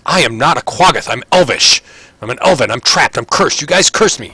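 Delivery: loud, high protest; indignant cursed elf. Acted. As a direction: insist on it, punching each short sentence. Cursed elf protests being called Quaggoth.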